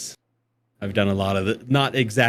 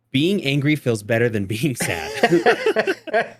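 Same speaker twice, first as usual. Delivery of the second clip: slow deadpan advice; much slower than usual. Retold or imitated. Advice to new parents.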